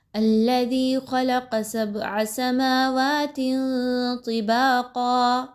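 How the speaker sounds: slow chant with nasal ikhfa on طِبَاقًا; tajweed recitation. Shown as a demonstration. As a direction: recite slowly, hiding the tanween. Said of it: "کیسے پڑھیں گے یہاں تک آیت کو". (اخفا کے ساتھ تلاوت)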